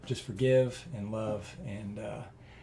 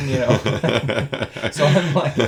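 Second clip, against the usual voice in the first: laughing; 9 dB louder than usual. Real, not acted. Laughing about praying in car.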